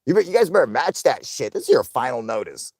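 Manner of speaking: high, loud, playful mock bossing of chat; mock demand. Streamer jokingly ordering viewers to match donations, loud mock demand. I'm joking or what that's from.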